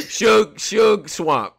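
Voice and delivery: loud, stretched-out ring announcer call; hype announce. Acted. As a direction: drag the names out like announcing a fight. Hyping the next battle matchup.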